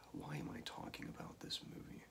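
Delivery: hushed, quick despairing aside; very soft, low, defeated muttering under the breath; weary whisper. Real, not acted. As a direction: whisper it to yourself in dismay. Despairs at the movie reviewed.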